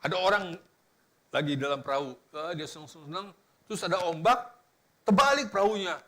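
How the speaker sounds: usual speaking voice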